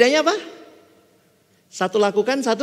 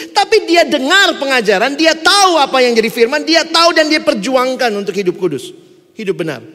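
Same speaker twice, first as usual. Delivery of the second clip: louder, higher urgent preaching; fiery preaching; 10 semitones higher, 8 dB louder than usual. Acted. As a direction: drive the warning home. Preaching at full force.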